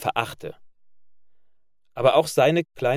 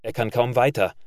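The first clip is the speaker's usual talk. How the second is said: plain reading voice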